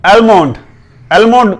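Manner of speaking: एलमोंड with the l sounded; common mispronunciation. Shown as a demonstration. Teacher demonstrates the common error, mispronounced. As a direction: clear "el" opening on एलमोंड. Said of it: "ये गलत pronounce किया जाता है".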